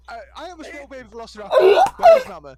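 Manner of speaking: hammed-up baby voice cracking into a laugh; baby velociraptor voice. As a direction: squeal for mama like a lost hatchling. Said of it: "uh, goes".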